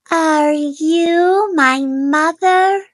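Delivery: high, slow little bird question; hopeful small chick; baby bird voice. A narrator's reading. Baby bird asks the dog, high and slow. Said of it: "he said to the dog".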